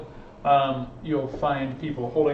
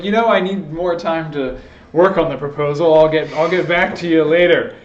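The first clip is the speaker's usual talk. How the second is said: loud explaining voice; 3 semitones higher, 10 dB louder than usual. Retold or imitated. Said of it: "It's like".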